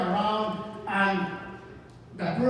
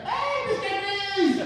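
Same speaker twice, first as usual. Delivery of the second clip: very high, slow mock call; pedestrian calling out; 11 semitones higher, slower than usual. Startled pedestrian hailing the Grinch driver, high drawn-out call. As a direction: stretch it out in a high put-on voice.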